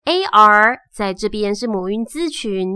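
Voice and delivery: ordinary talk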